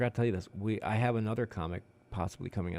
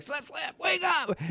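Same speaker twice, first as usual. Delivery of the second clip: slap the dummy and yell at it, hammed up; mock shout; slower than usual. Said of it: "I was like".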